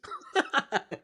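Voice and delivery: short high giggle breaking through; quick amused laugh; real laugh. Real, not acted. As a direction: laugh at the absurd letter. Reader laughs between letter lines.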